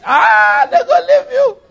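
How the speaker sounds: high, loud laughing cry; laughing shout. Real, not acted. Laughing shout after demo.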